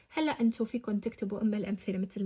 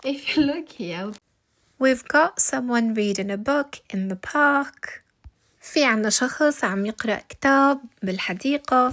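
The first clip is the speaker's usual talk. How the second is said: louder, slower; 2 semitones higher, 10 dB louder, slower than usual. Real, not acted. Teacher talks to camera while holding a biscuit.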